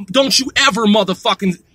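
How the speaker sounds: normal talking voice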